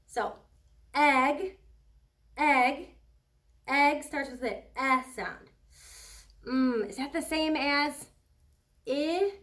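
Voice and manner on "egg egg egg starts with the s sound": "egg" repeated loud, its e vowel stressed; careful emphasis. Shown as a demonstration. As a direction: punch "egg" to show the vowel is close to i. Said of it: "listen very carefully".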